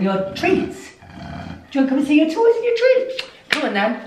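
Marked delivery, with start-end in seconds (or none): 1.7-3.2 much higher, louder and fast. Real, not acted. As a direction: hype the dog up quickly. Excited baby talk to dog.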